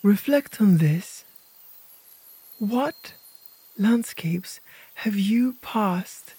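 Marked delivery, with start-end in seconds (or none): none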